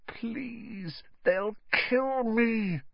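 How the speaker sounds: high, drawn-out plea; desperate pleading. A narrator's reading. Harry begging the elf not to, desperate pleading. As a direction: beg softly in a small high voice.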